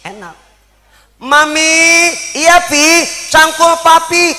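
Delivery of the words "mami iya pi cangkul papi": mock couple. Acting a poor couple's exchange.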